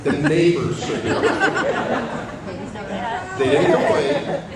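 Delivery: high, slow laughing tease. Real, not acted. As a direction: laugh through the punchline. Teasing about daughter's loud singing.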